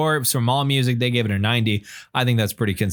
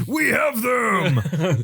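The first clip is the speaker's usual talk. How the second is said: slow, high, hammed-up shout; mock metal yell; 10 semitones higher, much slower than usual. Finishing the joke breakdown call, mock metal yell. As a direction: stretch it out like a deathcore call.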